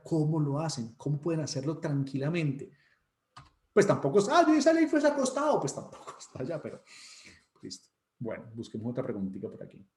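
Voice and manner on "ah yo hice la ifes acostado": high-pitched parody of a show-off; hammed-up exam brag; mock boastful student. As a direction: brag in a raised, put-on voice. Mimics a boastful exam taker.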